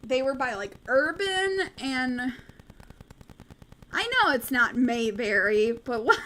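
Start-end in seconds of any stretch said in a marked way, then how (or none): none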